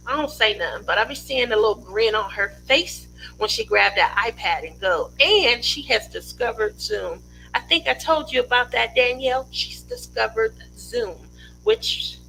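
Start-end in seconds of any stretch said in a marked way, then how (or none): none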